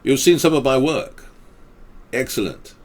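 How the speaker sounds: normal talking voice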